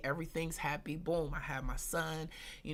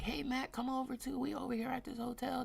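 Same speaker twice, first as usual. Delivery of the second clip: lightly mimicked friend, higher voice; imitated friendly invitation; 6 semitones higher than usual. Homegirls phone her to come over, higher imitated invitation.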